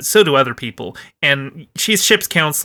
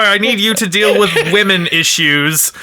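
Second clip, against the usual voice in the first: a hint of a commanding captain, higher and louder; mock captain; 5 semitones higher, 4 dB louder than usual. Picard summoning his counselor, lightly hammed-up. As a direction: play the flustered captain, lightly.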